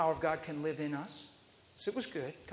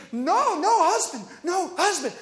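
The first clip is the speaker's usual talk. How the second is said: mock alarmed refusal; 10 semitones higher, 6 dB louder than usual. Lady refuses the hug.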